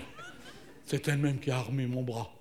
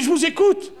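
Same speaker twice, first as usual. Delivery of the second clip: calling back; 13 semitones higher, 9 dB louder than usual. Pretend. Répond à la voix divine.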